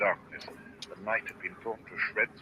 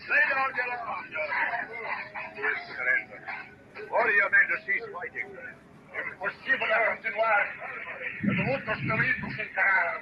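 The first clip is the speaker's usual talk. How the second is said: commanding officer; 9 semitones higher than usual. Acted. Boarding officer demanding surrender.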